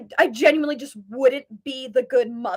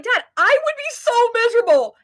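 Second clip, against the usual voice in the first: higher, louder, heated plea; getting heated; 10 semitones higher, 6 dB louder than usual. Retold or imitated. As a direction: plead with dad, voice rising with passion. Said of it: "I was getting heated".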